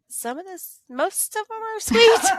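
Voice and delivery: ironic sweet. Acted. Sarcastic remark on students.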